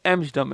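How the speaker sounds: एम्स्टर्डम with no T sound; silent T. Shown as a demonstration. Teacher correcting a common habit, एम्स्टर्डम with the T silent. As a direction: leave out the T in एम्स्टर्डम. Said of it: "पर टी silent है".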